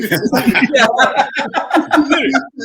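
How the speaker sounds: long burst of laughter; loud laugh. Real, not acted. Big laugh.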